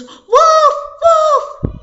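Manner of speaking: high, loud alarm shout; full-voiced false alarm; crying wolf. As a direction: yell it out high to rouse everyone. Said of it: "So he goes".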